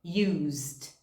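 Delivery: "Used" ends z straight into d, one syllable; teacher models "Used" as use-d. Shown as a demonstration. Teacher shows students the past tense. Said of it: "você vai falar used".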